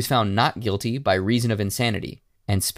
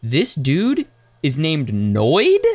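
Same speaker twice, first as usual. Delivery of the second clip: 1 incredulous realization; 4 semitones higher, 4 dB louder, much slower than usual. Pretend. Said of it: "and you're like".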